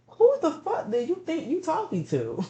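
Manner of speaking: high, indignant mock retort; mock outrage. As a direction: shoot back at a rude customer, half-joking. Mock retort to rude customers.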